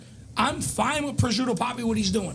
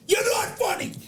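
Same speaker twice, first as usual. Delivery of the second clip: angry shout; 5 semitones higher than usual. Real, not acted. Telling the absent comedian off, shouting in real anger. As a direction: yell it at him like a verdict.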